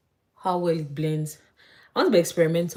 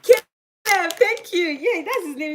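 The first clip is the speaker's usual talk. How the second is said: laughing; 12 semitones higher, 6 dB louder, slower than usual. Real, not acted. Laughs at forgetting the name.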